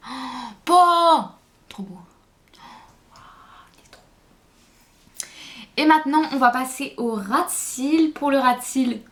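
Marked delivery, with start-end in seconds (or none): 0.1-2.0 émerveillée. Real, not acted. Admire son maquillage terminé. 2.0-5.3 chuchoté. Real, not acted. Admire son maquillage terminé.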